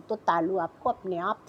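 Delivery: ordinary talk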